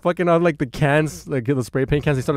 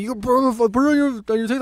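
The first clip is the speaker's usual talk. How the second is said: mimicked whiny tagger; 9 semitones higher, faster than usual.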